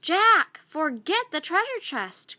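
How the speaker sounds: loud high calling cry; girl calling her brother urgently; cried. A narrator's reading. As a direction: call it from the treehouse window. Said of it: "cried Annie".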